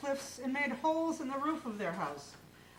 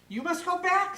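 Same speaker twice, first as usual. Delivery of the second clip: high, louder insistence; wife urging; 8 semitones higher, 7 dB louder than usual. A narrator's reading. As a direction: push him to go back. Wife urges Minglo.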